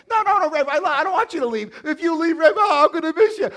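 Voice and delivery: high, loud mock pleading coworker; hammed-up begging voice. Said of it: "Come on, man".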